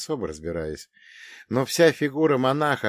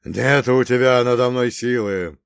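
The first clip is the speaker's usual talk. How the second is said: loud defiant priest voice; громкий вызов; 3 semitones higher, 8 dB louder than usual. Acted. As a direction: hurl it at the demon, loud. Монах противостоит нечистой силе. (громкий непокорный возглас)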